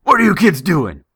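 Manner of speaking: loud angry yell from afar; yelling man. Retold or imitated. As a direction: shout it at the kids. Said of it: "we hear someone yell".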